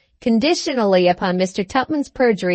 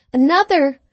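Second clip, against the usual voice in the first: higher outburst, falling at the end; shocked exclamation; 6 semitones higher, 4 dB louder than usual. A narrator's reading. Spinster hears he loves another, exclaims in surprise. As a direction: blurt it in astonishment. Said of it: "ejaculated the spinster".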